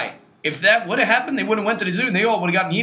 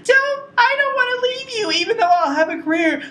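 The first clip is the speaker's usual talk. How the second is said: high, whiny mock girlfriend voice. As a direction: squeak it out like a lovesick teen. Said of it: "that's the one".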